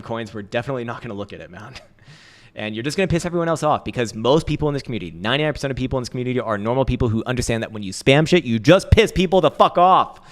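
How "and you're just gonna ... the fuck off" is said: loud rant. Real, not acted. Ranting at spammers.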